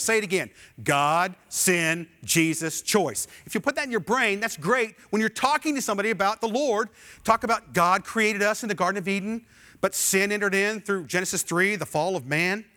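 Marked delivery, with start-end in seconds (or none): none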